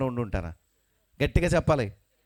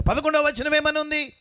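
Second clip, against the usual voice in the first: full-force preaching; 13 semitones higher, 6 dB louder than usual. Acted. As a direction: call the verse out over the congregation. Preacher calls next verse. (పెద్ద గొంతుతో వాక్యం చదవడం)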